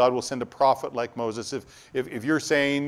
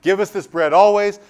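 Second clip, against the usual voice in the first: eager crowd; 8 semitones higher, 11 dB louder than usual. Retold or imitated.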